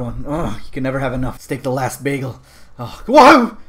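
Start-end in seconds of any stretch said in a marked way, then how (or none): 2.8-3.6 loud, high whoa; startled cry. Real, not acted. Player at spooky bread, startled cry. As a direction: jump back with a yell.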